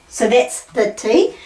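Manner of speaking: ordinary talk, nothing marked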